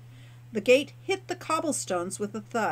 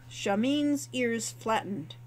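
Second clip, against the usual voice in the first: ordinary delivery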